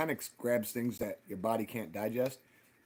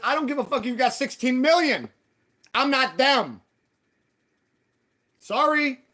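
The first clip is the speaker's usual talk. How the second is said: loud, high, defiant retort; reenacted heated refusal; retold angry comeback; 11 semitones higher, 9 dB louder than usual. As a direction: shout him down, pitched way up. Retells own angry comeback to landlord.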